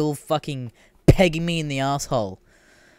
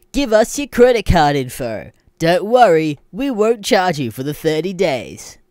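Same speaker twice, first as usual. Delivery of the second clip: higher and louder, fake reassuring; mock website voice; 5 semitones higher, 6 dB louder than usual. The website asking for card info, mock sweet. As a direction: promise no charge, insincerely. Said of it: "it's like".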